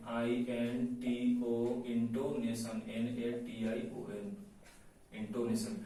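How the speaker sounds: low, soft, letter-by-letter dictation; slow spelling out. Shown as a demonstration. Teacher spelling intonation for students' notes, slow spelling out. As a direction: spell it slowly, voice dropped.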